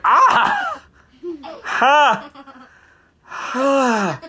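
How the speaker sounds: shrieking ha. Acted. Little girl in the story yelping as her eyeball goes back in. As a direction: yelp high then laugh it off. Girl's eye popped back.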